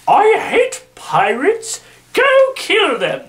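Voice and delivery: slow, high, put-on queen's voice, rising to a shriek; mock Queen Victoria. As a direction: camp up a wicked queen's order. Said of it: "as she says".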